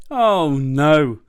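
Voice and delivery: acted fed-up wail; exasperated bear. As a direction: groan loudly, robbed of sleep. Mister Bear kept awake by fridge.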